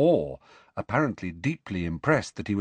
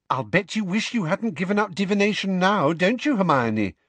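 put-on higher, teasing girl's voice with a smirk; 10 semitones higher than usual. A narrator's reading. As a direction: needle her with a smug grin.

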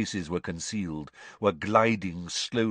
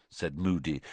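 ordinary delivery, nothing marked

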